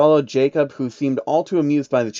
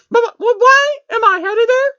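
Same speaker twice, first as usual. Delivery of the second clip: much higher, stammering pony voice; high stammering unicorn mare; Trixie's high voice; 9 dB louder than usual. A narrator's reading. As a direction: stutter it out in a small high voice. Trixie's frightened question.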